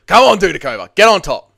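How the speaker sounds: high, loud yell at the fighter; urging shout. Real, not acted. Fan urging Dudakova to get on top, shouting. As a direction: holler them on from the couch.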